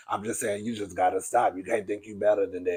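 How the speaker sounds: very low, soft, droning mockery; deep mock voice, endings dragged out; box-fan voice. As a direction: imitate a man talking into a fan. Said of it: "That's how he sound".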